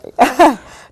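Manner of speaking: a loud tearful laugh; flustered real laugh to cover crying; embarrassed laugh. Real, not acted. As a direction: laugh it off through tears. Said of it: "Trying to keep my cool".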